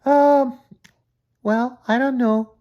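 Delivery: put-on high hesitant girl voice; nervous high Julie; Julie voice, unsure. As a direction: hedge nervously like a wary girl. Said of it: "she said".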